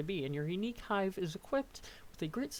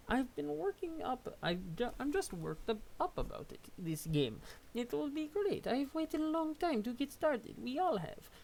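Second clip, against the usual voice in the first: excited high troll voice; 8 semitones higher than usual. Acted. Voicing excitable troll character.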